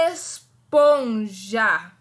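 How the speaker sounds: esponja stretched very slowly. Shown as a demonstration. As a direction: draw out esponja, syllable by syllable. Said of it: "repitam bem devagar".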